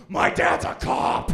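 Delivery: slow, loud unvoiced mock yell; hammed-up whispered shout; mock panicked warning. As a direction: blurt it out as a startled girlfriend. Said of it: "they go".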